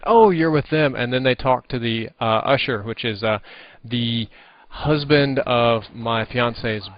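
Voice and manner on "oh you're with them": clerk voice. Retold or imitated. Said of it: "then they talk to my best man".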